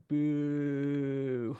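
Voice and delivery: long, drawn-out heckle; yelled boo. Acted. Woman heckling the band on stage, yelled boo. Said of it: "a woman yell".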